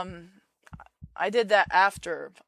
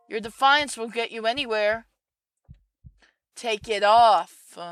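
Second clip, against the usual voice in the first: stern mother command; 4 semitones higher, 10 dB louder than usual. Acted.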